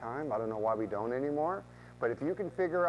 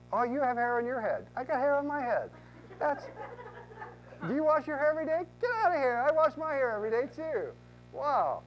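gushing small talk; 12 semitones higher than usual. Pretend. Realtor faking common ground with client, hammed-up high gushing. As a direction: ham up fake excitement over nothing.

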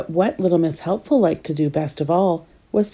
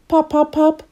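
popping balloons; 9 semitones higher than usual. Acted. Balloons popping voiced again.

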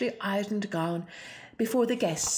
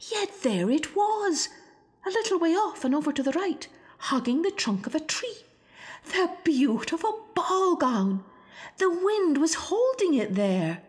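thrilled, near tearful discovery; excited wonder; 8 semitones higher than usual. A narrator's reading. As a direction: let the joy break through. Narrator sees the lost gown.

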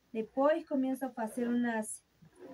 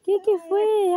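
startled; 7 semitones higher, 6 dB louder than usual. Real, not acted. Startled by the dog arriving.

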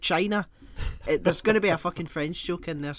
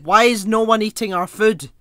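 loud, higher indignant cry; hammed-up exasperated yell; exasperated shout; 6 semitones higher, 14 dB louder than usual. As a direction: complain at full voice, mock outrage. Imagined Romans complaining, acted quote.

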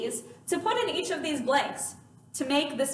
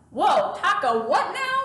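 higher, mock-baffled reaction; baffled students; 4 semitones higher, 3 dB louder than usual. Teacher imitates students confused by takadimi, mock bewilderment. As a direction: imitate students thrown by a hard word. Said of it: "you were like".

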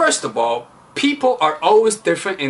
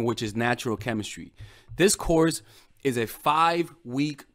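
softer, steady ad read; course promo; 7 dB quieter than usual. Acted. Coach advertising her course to women, calm promo read. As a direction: pitch the course calmly to viewers.

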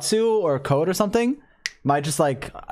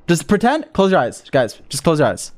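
ordinary talk

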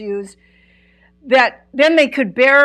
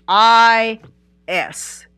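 each letter of "I S" drawn out and separate; spelled out for emphasis. Real, not acted. Preacher stressing a word to the congregation, spelled out emphatically. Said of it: "I want to emphasize the word is".

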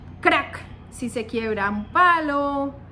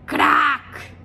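cracking sound; 2 semitones higher, 5 dB louder than usual. Shown as a demonstration. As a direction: crack said like a break. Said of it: "Encontraste de pronto". (crack como algo que se quiebra)

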